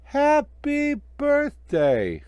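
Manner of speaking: very high, loud and very slow; Frosty wakes with a joyful slow shout; snowman's first words. Acted. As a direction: drawl it out as a newborn snowman. Snowman comes to life.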